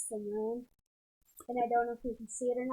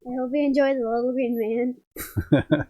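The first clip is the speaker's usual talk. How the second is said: louder repeat; 8 dB louder than usual. Real, not acted. Daughter repeats her message to Dave after "not on", loudly. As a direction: say it loud so it gets picked up.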